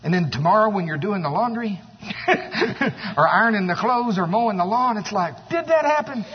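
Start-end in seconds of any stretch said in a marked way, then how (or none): 5.5-6.2 doubting disbelief. Retold or imitated. Doubting after a mountaintop experience.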